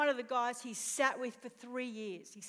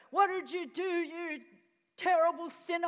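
raised, higher rebuking voice; angry scolding; 5 semitones higher, 6 dB louder than usual. Acted. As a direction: scold like an outraged accuser. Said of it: "he's expecting Jesus to go".

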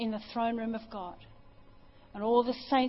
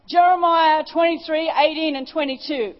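strong voice of God; 5 semitones higher, 12 dB louder than usual. Retold or imitated. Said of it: "in a really strong voice".

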